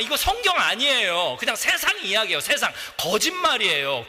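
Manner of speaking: ordinary talk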